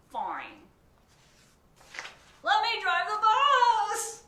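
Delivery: very loud, high screaming tantrum; tantrum shout. Acted. Pigeon throws a fit at the reader, loud tantrum scream. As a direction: explode in a kid's tantrum.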